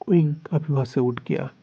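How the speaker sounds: plain reading voice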